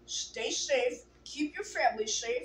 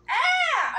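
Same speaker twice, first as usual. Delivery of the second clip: sudden high loud scream; 8 dB louder than usual. Acted. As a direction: shriek in fright. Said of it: "I screamed".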